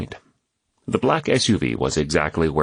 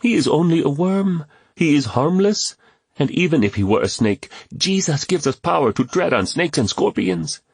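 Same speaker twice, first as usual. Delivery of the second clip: put-on higher, fearless young boy; boy's voice; 9 semitones higher than usual. A narrator's reading. Kwame encouraging James about Jata, fearless boy's voice. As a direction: reassure like a brave small boy.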